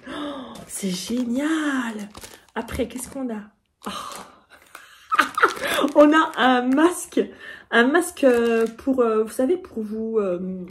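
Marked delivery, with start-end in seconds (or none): 5.1-5.9 rire aigu. Real, not acted. Découvre un objet.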